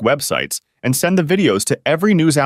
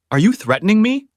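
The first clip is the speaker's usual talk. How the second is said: angry question; 11 semitones higher than usual. A narrator's reading. Judge angered by inmate.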